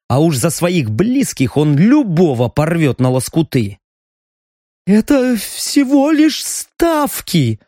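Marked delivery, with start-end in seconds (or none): 4.9-7.6 high, slow nasal whine through a broken nose; whiny nasal voice. A narrator's reading. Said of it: "жалобно прогнусавил парень с разбитым носом".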